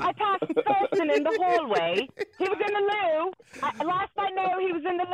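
playful put-on British accent; hammed-up British radio reply; mock British accent. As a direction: report in like a pretend Brit. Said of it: "phenomenal with these British accents".

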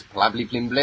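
"Blably Pimblett" with a lisping B-L start instead of "Paddy"; mispronounced name. Retold or imitated. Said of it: "He goes".

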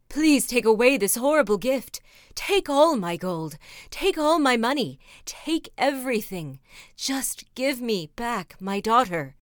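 loud, begging king; desperate pleading. Acted. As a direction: plead loudly for the daughter back. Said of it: "he cried out to the wizard".

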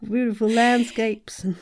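slow, dreamy Southern Belle accent, lightly played. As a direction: swoon over it like a Southern Belle. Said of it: "that Southern Belle accent".

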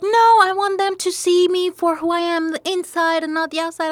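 a hint of a stubborn young guy; imitating her friend. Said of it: "So he's like".

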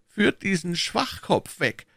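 high, slow, deliberate order; commanding. A narrator's reading. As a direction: give the order slowly, pitched high. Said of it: "befahl der Kardinal". (langsamer, hoher Befehl)

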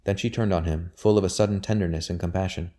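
ordinary delivery, nothing marked

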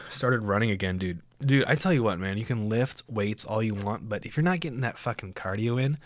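normal talking voice

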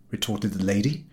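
plain reading voice

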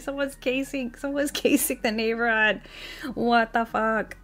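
high, laughing mock panic; a squeaky half-laughing freakout. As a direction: play up the fright with giggles. Acting her panic at the news.

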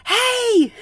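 high, loud shout at the deer; calling out. Retold or imitated. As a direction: yell across the yard to shoo them. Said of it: "and I'm like".

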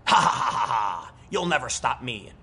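hint of a defiant, laughing villain; lightly acted maniacal taunt with forced ha-ha laughter; the Joker laughing. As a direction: throw out a mock villain cackle mid-taunt. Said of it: "It's like the Joker in that final scene".

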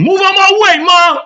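a son shouting at his mother blocking the door; yelling. A narrator's reading. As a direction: yell at your mother to get out of the way. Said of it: "Rogan is scowling and yelling".

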